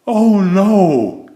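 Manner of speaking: high, loud gasp of horror; gasped dismay. Acted. Mister Fussy finding the flooded bathroom, gasping in dismay. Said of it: "he gasped".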